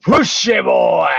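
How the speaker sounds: put-on high, loud yell of "Push it, boy!"; shouted catchphrase. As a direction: belt it out like a streamer's catchphrase. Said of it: "the famous two words".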